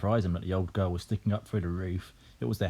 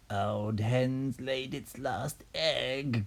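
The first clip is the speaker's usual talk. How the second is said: put-on slow, drawn-out old Grandma voice; shouting Grandma; 4 semitones higher, much slower than usual. A narrator's reading. Grandma heckling about the old hen, slow put-on shout. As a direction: call out slowly in a put-on granny voice. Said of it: "Grandma shouted".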